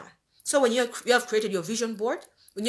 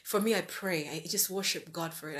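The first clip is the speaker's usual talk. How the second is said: ordinary talk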